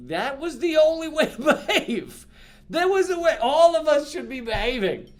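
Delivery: high, loud disbelief with a laugh; incredulous future self. Retold or imitated. Future person looking back on today, laughing disbelief. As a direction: scoff at how obvious it was, laughing. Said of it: "be like".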